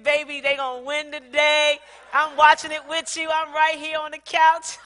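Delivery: bursting with game-day glee; excited phone call. Retold or imitated. Calls husband about winning game.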